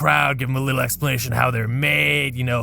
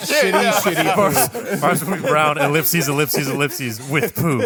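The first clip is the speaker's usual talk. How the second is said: giggling through the words; barely holding it together, laughing. Real, not acted. Cracking up while riffing on Matt Damon's Mars line.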